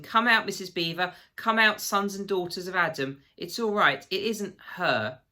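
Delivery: high, loud happy shout. A narrator's reading. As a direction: call out loudly from outside. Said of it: "he was shouting".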